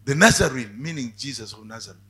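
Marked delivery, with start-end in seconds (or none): 0.1-0.8 louder cry on the deathbed. Retold or imitated. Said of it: "He said".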